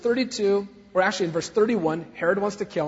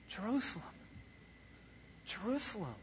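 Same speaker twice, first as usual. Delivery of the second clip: much softer, breathy-quiet grieving call; sad lament; 2 semitones higher, 17 dB quieter, faster than usual. Acted. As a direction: mourn the city tenderly. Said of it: "It's sadness".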